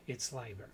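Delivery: Leiber restated as LYE-ber; "Leiber", first syllable rhyming with "eye"; corrected pronunciation. Shown as a demonstration. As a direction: say Leiber with a long I. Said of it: "No, it's Leiber".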